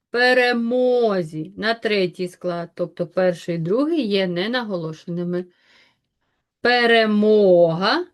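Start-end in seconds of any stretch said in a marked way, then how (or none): 0.1-1.5 Перемозі drawn out, with a long stressed МО; stress on third syllable. Shown as a demonstration. Teacher showing pupils where the stress falls, stressed syllable stretched. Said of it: "ставимо наголос". 6.6-8.1 Перемога loud and slow, with a long МО; stress on third syllable. Shown as a demonstration. Teacher marking stress for pupils, loud drawn-out stressed syllable. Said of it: "так само".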